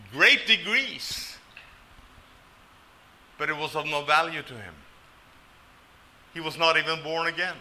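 ordinary talk

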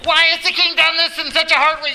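the harsh way Daniel could have asked; harsh demanding read. Shown as a demonstration. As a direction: fire the question at him in outrage. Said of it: "you could read this in two, two different ways".

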